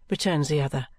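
ordinary delivery

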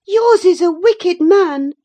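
put-on high, loud girl's retort; angry retort. A narrator's reading. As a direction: hurl it back indignantly, pitched high. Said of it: "retorted Catherine".

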